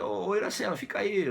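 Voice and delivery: high, lightly acted call.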